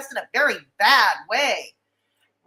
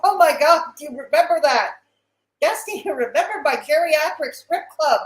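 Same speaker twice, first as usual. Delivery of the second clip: laughing; 4 semitones higher, 6 dB louder than usual. Real, not acted. Laughing at a chat memory.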